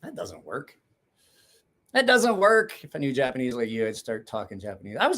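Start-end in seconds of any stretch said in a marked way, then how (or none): 0.0-0.8 softer, under-the-breath aside; quiet aside. Real, not acted. Reacting to chat, a quiet aside to himself. 1.9-2.8 higher, louder, put-on retort. Real, not acted. Answering a viewer in chat with a playful higher retort.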